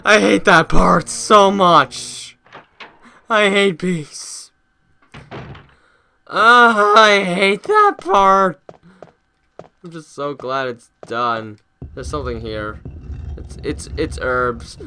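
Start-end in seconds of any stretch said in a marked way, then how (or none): 0.0-8.6 slow, loud drawn-out complaint; slow relieved groan. Real, not acted. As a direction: drag each word out in relief. Relieved after chase.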